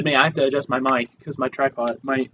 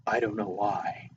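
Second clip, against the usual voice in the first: low, slow murmur; 4 semitones lower, 12 dB quieter than usual. Real, not acted. Low take before Walken impression.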